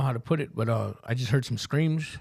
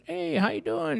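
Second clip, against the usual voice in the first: much higher, breezy mock-friendly hello; higher-pitched imitation of the father saying hello; imitating dad's greeting; slower than usual. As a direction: play the dad as a bright, overly casual greeter. Son meets father, father's greeting.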